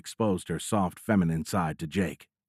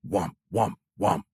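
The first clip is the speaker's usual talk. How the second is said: droning alarm "womp", evenly repeated; imitated alarm. A narrator's reading. Cockpit alert drills into the pilot's brain, imitated alarm. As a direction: voice a cockpit warning tone, pulse by pulse. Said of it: "The sound drilled into Jake's brain".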